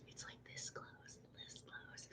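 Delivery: tiny confiding whisper. Real, not acted. Telling viewers the book is almost done, whispered. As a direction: whisper it as a secret aside. Showing how nearly finished it is.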